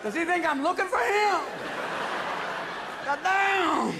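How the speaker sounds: high, indignant comic impression. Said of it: "Even [name]'s going".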